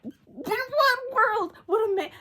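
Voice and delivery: high, loud laughing outrage; laughing disbelief. Real, not acted.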